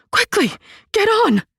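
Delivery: high, loud breathless call; panted urgency. A narrator's reading. Mother panting at her son to board quickly. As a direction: gasp it out in a hurry. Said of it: "she panted".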